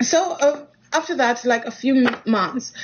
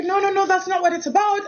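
panicked denial; 10 semitones higher than usual. Retold or imitated.

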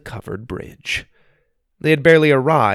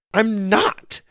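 higher, louder protest; 11 semitones higher than usual. Acted. Susie protesting to Wonka, louder. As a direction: insist more loudly. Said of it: "Susie said even louder".

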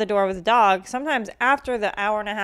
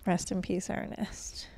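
mock solemn whisper; 9 dB quieter, slower than usual. Mock eulogy for the dog.

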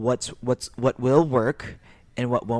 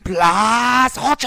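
much higher and louder, slow drawn-out shout; anime battle cry; 12 semitones higher, 11 dB louder, much slower than usual. Pretend. Cohost asks for the catchphrase, hero battle cry. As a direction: belt it like an anime hero powering up. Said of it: "Can you give your boy a plus ultra?".

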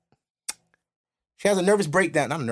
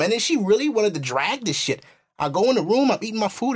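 usual speaking voice

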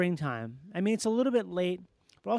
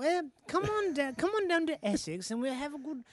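put-on tipsy posh British accent, higher and slowed; drunken posh British accent; 3 semitones higher than usual. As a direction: slur it like a tipsy English squire. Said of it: "You could be wasted and you just".

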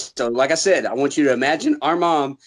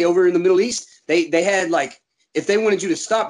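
ordinary delivery

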